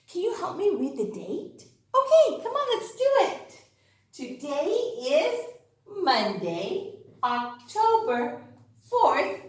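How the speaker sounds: usual speaking voice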